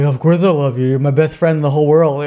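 mock drunken declaration of love; slurring drunk. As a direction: imitate a drunk gushing to his best friend. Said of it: "like, slurring over the world".

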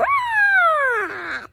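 high, loud drawn-out scream; playful scream. Pretend. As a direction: let out a high playful shriek. Sudden scream in playful vlog.

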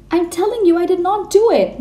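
high, loud scream. A narrator's reading. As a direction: shriek the denial at the headmistress. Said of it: "Matilda screamed".

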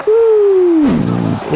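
high excited ouh; surprised hoot. Real, not acted. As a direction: whoop at the big hit. Reacting to big move. (cri de surprise aigu)

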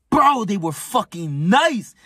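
high, drawn-out hyped emphasis; excited, slow and emphatic; hyped admiration. Real, not acted. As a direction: stretch it out high, really impressed. Hyping up tough opponents.

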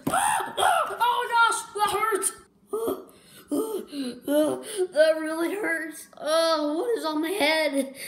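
high, loud pained wail; crying out in pain. Acted. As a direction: cry out as if struck on the head. Hit on head, crying out.

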